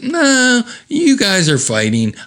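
high, insistent objection; insistent Diane. Acted. Voices Diane contradicting him.

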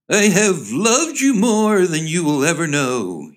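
high, slow, hammed-up suitor; overblown declaration of love. Young man wooing his girl, overblown declaration. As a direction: draw it out like a melodramatic lover.

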